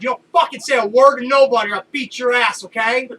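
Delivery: loud half-joking threat; mock threat. Warning the laughing boss, a loud mock threat. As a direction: warn him off, loudly and half in jest. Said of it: "I said".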